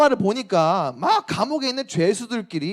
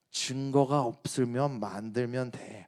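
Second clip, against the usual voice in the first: Ju Dante line; 7 semitones lower, 9 dB quieter, slower than usual. Retold or imitated. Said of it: "주 단테가 멋진 명대사를 날렸죠".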